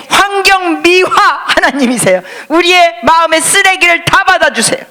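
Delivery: loud, high exhortation; fervent sermon call; full-force preaching. Acted. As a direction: urge the congregation from the pulpit.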